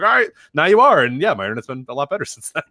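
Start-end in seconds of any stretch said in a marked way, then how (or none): 0.0-1.0 high, louder cheerful agent voice; high, bright customer-service quote; brisk rep. Retold or imitated. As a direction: chirp it like a helpful agent. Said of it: "They're like".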